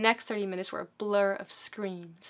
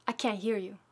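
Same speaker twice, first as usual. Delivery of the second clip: ordinary delivery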